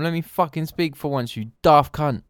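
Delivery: mock angry rant. Friend snapping about interruptions.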